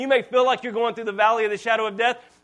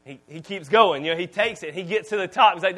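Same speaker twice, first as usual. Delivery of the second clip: ordinary talk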